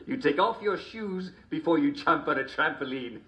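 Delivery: ordinary talk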